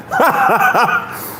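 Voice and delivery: high burst of laughter; real laugh. Real, not acted. Host amused by the viewers' comments, high laughter. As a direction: cackle helplessly for a moment.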